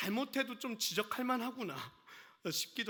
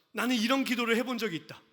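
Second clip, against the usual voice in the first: loud impassioned prayer, rising, laughing at the peak; loud, laughing preacher praying for the saints; fervent preaching; 3 semitones higher, 7 dB louder than usual. Acted. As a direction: pour it out like a pleading prayer.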